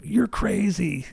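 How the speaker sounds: a lightly voiced, drawn-out disbelief; retold driver. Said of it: "And he's like".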